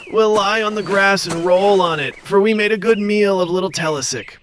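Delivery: high, gloating chant; dragons gloating. Acted. Dragons gloating after the feast, high chant. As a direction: gloat over the meal. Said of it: "they cried".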